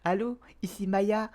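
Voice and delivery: put-on high bright young woman voice; Maya voice. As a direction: raise the pitch for Maya. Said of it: "il faut que je trouve une voix pour Maya".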